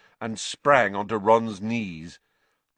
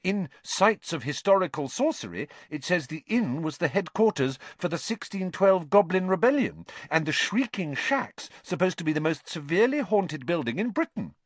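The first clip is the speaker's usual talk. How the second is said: high-pitched keen recital; eager; 9 semitones higher than usual. A narrator's reading. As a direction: rattle off facts with eager interest. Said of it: "Hermione pressed on eagerly".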